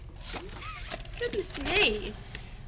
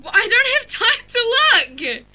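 panicked; 6 semitones higher, 7 dB louder than usual. Real, not acted. Panicking while chased.

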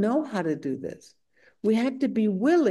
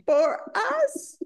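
emphatic, higher, animated recitation; scripture quote read with relish; 4 semitones higher than usual. Acted. Householder tells the Buddha why renunciation daunts them, emphatic and high. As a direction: read it out grandly, savoring each phrase.

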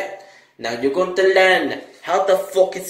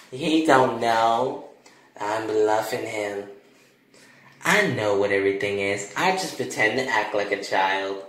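low, lightly voiced boy letting out his secret; sly kid confession; 7 semitones lower than usual. Boy admitting he bluffs his father, sly and smug. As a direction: confide it smugly, slowing down.

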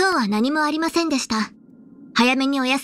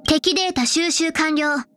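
ordinary talk

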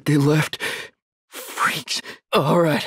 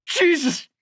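high, anguished outcry; horrified grief; 8 semitones higher than usual. Acted. Survivor finding what the freaks did to a friend, anguished disbelief. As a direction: cry out in shock at a terrible loss. Finds a friend killed by monsters.

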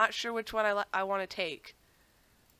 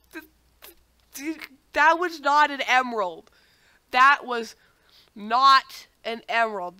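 indignant outburst; 4 semitones higher, 7 dB louder than usual. Real, not acted. Tricked by fake emerald trap.

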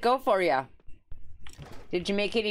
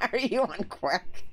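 slow, amused laughing question; giggly, teasing question. Real, not acted. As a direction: ask it through a laugh. Laughing at the odd phrasing.

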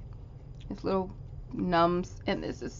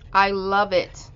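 usual speaking voice

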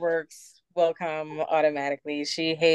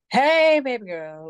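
sleepy mom greeting; 8 semitones higher, 3 dB louder, slower than usual. Retold or imitated. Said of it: "wiping out the crust out of my eyes".

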